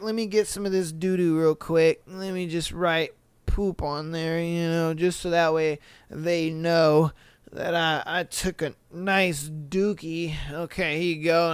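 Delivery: imitated nonchalance. Guy writing in poop on the wall, mock-casual. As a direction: play him quietly busy with his mess. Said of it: "He just like".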